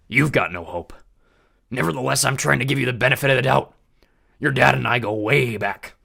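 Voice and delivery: loud swaggering biker god; brash god of war. A narrator's reading. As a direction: taunt the kid with easy menace. Ares voiced through dialogue.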